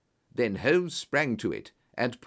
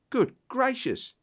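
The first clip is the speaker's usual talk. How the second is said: high, drawn-out exclamation of shock; startled cry; 13 semitones higher than usual. A narrator's reading. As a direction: cry out, pitched right up, slowly. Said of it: "cried Pycroft".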